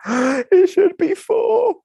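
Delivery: high, slow, anguished protest; dismayed outcry. Retold or imitated. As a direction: wail it out in shock.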